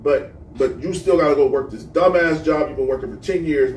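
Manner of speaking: usual speaking voice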